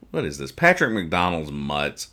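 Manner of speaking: ordinary talk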